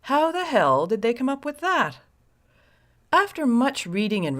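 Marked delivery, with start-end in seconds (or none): 0.0-2.0 higher, incredulous imagined listener; mock disbelief. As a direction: ask it like you can't believe it. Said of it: "you may say".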